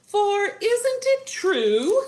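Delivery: high, slow, stretched question; singsong wife making her point; wizard's wife voice. A narrator's reading. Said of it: "said the wizard's wife".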